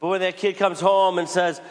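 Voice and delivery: ordinary delivery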